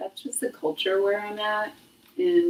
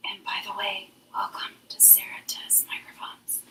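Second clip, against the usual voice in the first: hushed, voiceless whisper; 11 dB quieter than usual. Shown as a demonstration. Host testing if the microphone catches it, whisper. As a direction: whisper the show welcome like a secret.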